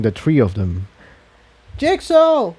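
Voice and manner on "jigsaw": high voice, name flung across the room; shouting his name. Acted. Incendia spots Jigsaw across the hall, shouting his name. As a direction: call out to him desperately. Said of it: "Incendia shouted".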